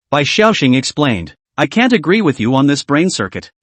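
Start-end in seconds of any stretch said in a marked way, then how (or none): none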